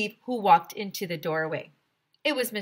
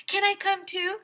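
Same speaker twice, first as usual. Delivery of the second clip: put-on eager kid; 13 semitones higher, 3 dB louder than usual. A narrator's reading. Child begging to follow teacher.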